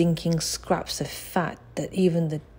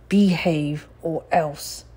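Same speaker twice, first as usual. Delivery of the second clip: slow, menacing warning; threatening; slower than usual. A narrator's reading. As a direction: lean on each word as a threat. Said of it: "he threatened".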